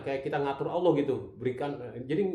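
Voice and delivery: normal talking voice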